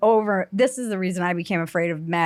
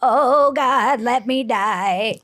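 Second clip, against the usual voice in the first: loud, higher, drawn-out mock wail; imitating dying old lady; 4 semitones higher, 3 dB louder, much slower than usual. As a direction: ham up an old woman begging to die. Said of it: "She'd be like".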